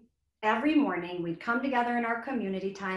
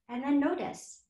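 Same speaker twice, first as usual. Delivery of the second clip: calm exhale; 4 semitones higher, 3 dB quieter than usual. Shown as a demonstration. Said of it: "breathing exercise demo".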